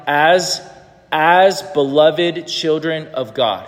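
measured, deliberate recitation; slow reading aloud. Shown as a demonstration. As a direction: read slowly, word by word. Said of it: "Would you read this out loud?".

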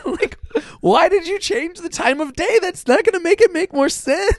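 high-pitched comic outrage; worked-up, high exasperated complaint; exasperated disbelief. Real, not acted. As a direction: protest in an incredulous squeal. Laughing outrage at pointless change.

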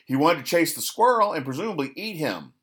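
normal talking voice

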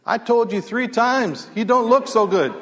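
high, emphatic comic punchline; exasperated reminder. Retold or imitated. Old horse seller answering the angry rancher, exasperated reminder.

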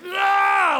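high loud Hulk Hogan roar; wrestler roar. Shown as a demonstration. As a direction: roar like a wrestler tearing his shirt. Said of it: "like that".

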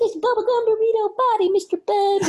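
put-on high, fast cooing baby talk; high fast pet baby talk; doting owner. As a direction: gush over the dog's belly. Guy cooing to his dog.

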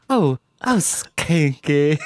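high, breathy-free giggly Southern Belle swoon. Pretend. Host teasing co-host Skanky, Southern Belle accent. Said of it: "the most random accent ever".

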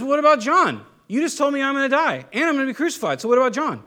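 high-pitched, insistent protest; blurting out loud. Acted. Peter challenging Jesus about John, blurting it out loud. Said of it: "blurts out verbally".

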